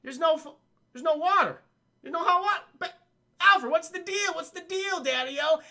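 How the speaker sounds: high, loud, indignant whine; whiny Batman. Retold or imitated. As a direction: squeak out the complaint like a spoiled rich kid. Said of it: "Che is like".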